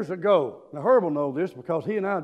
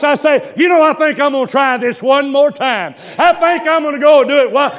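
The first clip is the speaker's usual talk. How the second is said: full-force preaching; 6 semitones higher, 12 dB louder than usual. Acted. Preacher lifting the church, loud fervent preaching. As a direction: preach it to the back pew.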